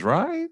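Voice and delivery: Right? leaping way up; upswing tail. Retold or imitated. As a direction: echo back a high rising Right?. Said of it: "The other person says".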